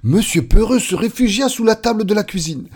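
high, loud, alarmed storytelling; panicked narration. A narrator's reading. Narrator catches the character's panic. (récit affolé, aigu et fort)

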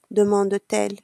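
usual speaking voice

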